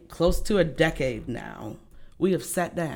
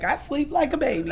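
laughing; 8 semitones higher than usual. Retold or imitated. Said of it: "I was like".

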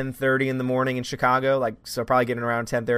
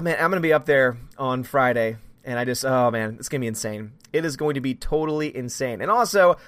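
ordinary delivery

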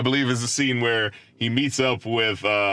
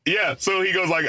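ordinary delivery

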